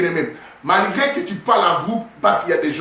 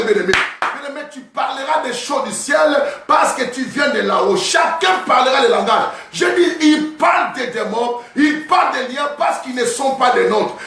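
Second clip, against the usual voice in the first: fiery preaching; 4 semitones higher than usual. Acted. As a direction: preach it out to the congregation. Preaching at full force.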